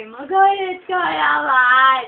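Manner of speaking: very slow, stretched mock demand; long-drawn playful nagging; drawn-out plea. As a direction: drag out every word as you plead. Begging again, words dragged out.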